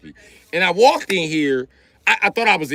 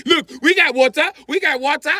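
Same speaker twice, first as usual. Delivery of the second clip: high defensive insistence; 13 semitones higher than usual. Retold or imitated. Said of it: "was like".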